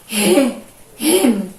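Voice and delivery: "re-, remo", first syllable alone, then whole; strong initial R. Shown as a demonstration. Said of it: "Sai o som bem forte".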